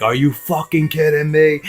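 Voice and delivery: half-yell it in mock outrage. Imitating angry relatives piling out of the car, mock yell. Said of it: "They start yelling".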